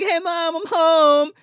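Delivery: put-on squeaky little-girl voice, high up; bright high kid greeting. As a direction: chirp it like a cheerful kid coming home. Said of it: "She's like".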